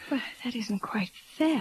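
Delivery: soft hurt whisper; hushed mild reproach. Acted. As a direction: protest gently under breath. Gentle protest to distressed Michael.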